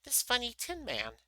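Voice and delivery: very high little mouse voice; mouse queen. A narrator's reading. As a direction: answer as the tiny queen, high-pitched. Mouse queen answers her mice.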